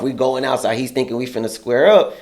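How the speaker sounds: normal talking voice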